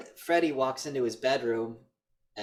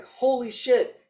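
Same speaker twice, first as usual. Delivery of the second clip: high, louder, breathless mock cop; excited cop; 10 semitones higher, 5 dB louder than usual. Cop bursts in on the killer, high excited mock yelling. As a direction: play a startled cop yelling hands up.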